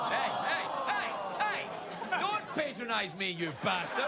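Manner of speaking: high, loud hammed-up indignation; playful scolding, rising then falling; mock outrage. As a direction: cut them off with pretend fury. Mock quarrel with laughing audience.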